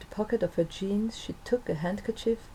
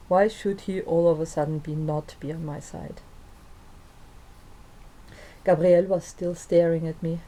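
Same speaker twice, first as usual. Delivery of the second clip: ordinary delivery, nothing marked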